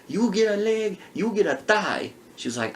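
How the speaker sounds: normal talking voice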